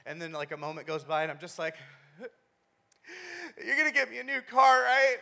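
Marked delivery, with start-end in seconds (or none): 3.6-5.2 high, loud fake-crying wheedle; mock tearful plea. Injured son asking dad for a car, mock tearful plea. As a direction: beg through put-on tears, comically hopeful. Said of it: "and I start crying".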